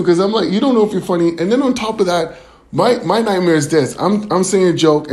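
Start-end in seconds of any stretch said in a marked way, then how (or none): none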